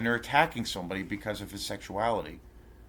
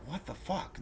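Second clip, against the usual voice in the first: soft, higher, incredulous retold reaction; hushed disbelief; 7 semitones higher, 9 dB quieter than usual. Vlogger recalls thousands of viewers ignoring the comment, hushed disbelief. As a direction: drop the volume and lift the pitch, baffled.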